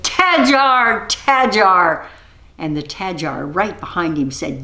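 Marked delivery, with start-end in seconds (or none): 0.0-2.1 calling out. Acted. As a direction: call into the woods. Ranger calls the Tajar.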